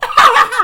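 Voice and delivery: very loud, high cackle; hammed-up shrieking laugh; mock maniacal laugh. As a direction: burst into crazed acted laughter. Acting character's laugh after warning.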